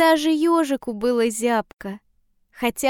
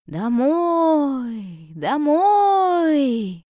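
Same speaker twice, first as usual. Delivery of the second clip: long swooping wail, rising on the repeat; howling blizzard winds; 4 semitones higher, much slower than usual. A narrator's reading. Blizzard driving the travellers back, drawn-out howl. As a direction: howl the words like wind. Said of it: "выли вихри".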